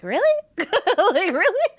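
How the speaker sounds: high, incredulous "really?"; surprised. Retold or imitated. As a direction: act amazed, half laughing.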